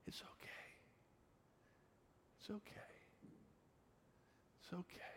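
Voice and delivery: soothing whisper with long pauses; very soft whisper into a child's ear. Retold or imitated. Dad whispering into screaming daughter's ear, soothing. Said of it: "whispering into her ear".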